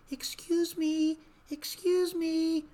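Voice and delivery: high, slow, timid calling out; a shy woman failing to be heard; timid little lady. Retold or imitated. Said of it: "she's very timid".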